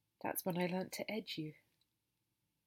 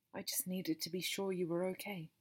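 plain reading voice

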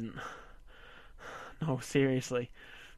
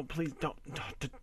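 usual speaking voice

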